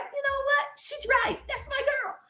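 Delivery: high, soft admiring fan voice. Retold or imitated. Follower cheering on the coach, high and soft. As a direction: coo it like a proud fan. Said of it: "people can look at you and be like".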